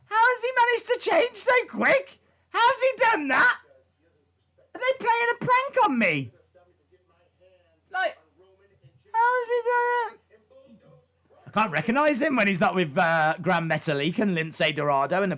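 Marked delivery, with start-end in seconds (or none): 0.1-10.2 high, loud, baffled questioning; incredulous inner voice. Retold or imitated. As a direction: replay your own disbelief, pitched way up. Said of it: "I was like".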